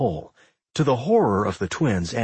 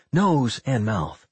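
usual speaking voice

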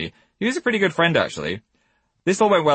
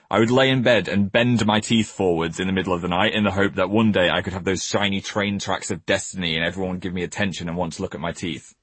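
ordinary delivery

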